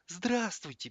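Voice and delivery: high, small squeak; Radzinsky. A narrator's reading. Presenter greets viewers, high squeaky voice. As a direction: squeak a thin little greeting. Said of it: "пискнул Радзинский".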